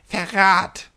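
put-on little old man cries treason; old man's cry. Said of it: "sagte der kleine, gichtige Alte".

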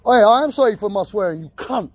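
ordinary delivery

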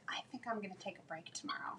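breathy stage whisper, almost silent. Pretend. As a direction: whisper it like a secret. Playfully announcing a break.

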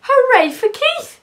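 high, loud jubilant hooray; cheering cry. A narrator's reading. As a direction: lead the cheer for the hero. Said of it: "cried the cats".

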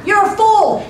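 high, loud yell thrown back; echoed taunt. Acted. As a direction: mimic the boy's taunt as an echo. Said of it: "The voice came back".

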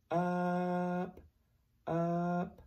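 "up" stressed to show the short u sound; letter sound. Shown as a demonstration. Teacher demonstrating the sound U makes, stressing "up". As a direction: stretch the u in "up" for the kids. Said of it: "what sound does U make?".

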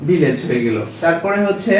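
usual speaking voice

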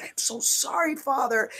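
high, soft, pleading apology; retold tearful apology. Woman retelling her prayer to Father, retold tearful apology. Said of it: "I was sobbing like a baby".

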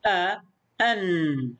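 أ، أن drawn out, ending on a held n; short "a", then "an" with a humming nun; slow teacher's demo of أ turning into أن; vowel, then tanween. Shown as a demonstration. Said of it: "لاحظ الآن النون التي يقصدونها هنا في النطق".

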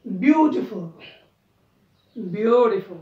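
ordinary delivery

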